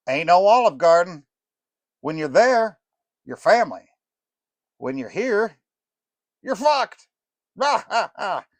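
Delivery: a burned-out rock star calling out; aging rocker. Acted. Rocker greeting the fudge makers, loud and high. Rocker joking about food.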